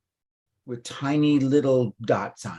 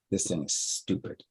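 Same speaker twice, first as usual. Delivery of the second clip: muttered frustration; 6 semitones lower, 11 dB quieter than usual. Retold or imitated. Frustrated aside after failed wish.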